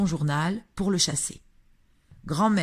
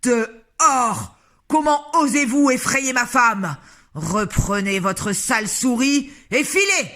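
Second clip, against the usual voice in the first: much louder, furious yell; 7 semitones higher, 8 dB louder than usual. A narrator's reading. Mr Jenkins yelling at grandmother to get out, loud and furious. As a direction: roar them out of the room. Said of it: "en hurlant de plus belle".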